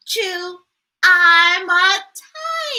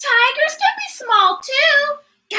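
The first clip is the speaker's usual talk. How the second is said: ordinary delivery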